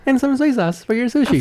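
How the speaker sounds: high, fake server voice; exaggeratedly high hostess impression; put-on female voice. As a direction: put on a high-pitched act. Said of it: "She's just putting on an act".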